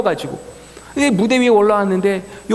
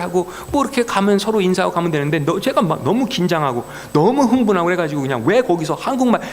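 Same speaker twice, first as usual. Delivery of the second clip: normal talking voice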